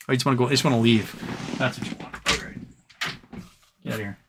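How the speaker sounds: soft half-whispered grumble at the cat; muttered aside to cat. Real, not acted. Streamer grumbling to his departing cat, half-whispered. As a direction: let it drop to a breathy aside.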